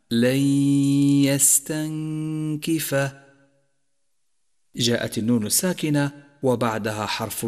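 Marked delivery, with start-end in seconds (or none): 0.1-3.1 "لن يستنكف" chanted, n merged into a long nasal y; merging with ghunna. Shown as a demonstration. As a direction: hold the nasal glide in "لن يستنكف". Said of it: "إدغام بغنة". («لن يستنكف» بإدغام النون في الياء بغنة)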